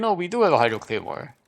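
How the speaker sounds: ordinary talk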